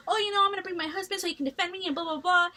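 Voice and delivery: high, quick mocking rant; mock popping off. Classmate popping off at the husband, mockingly imitated. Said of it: "pops off on him".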